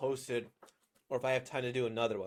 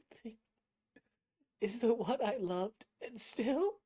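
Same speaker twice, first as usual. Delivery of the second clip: mind break; 9 semitones higher than usual. Shown as a demonstration. Voice actor as the character mourning the one they loved, mind breaking. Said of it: "getting a mind break type thing".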